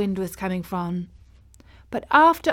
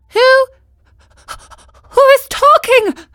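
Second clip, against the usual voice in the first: high, loud, frightened question; frozen with terror; high, loud, scared puppet; terrified; 9 dB louder than usual. A narrator's reading. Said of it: "frozen with terror".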